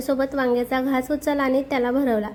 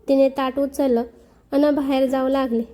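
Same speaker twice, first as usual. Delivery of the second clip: usual speaking voice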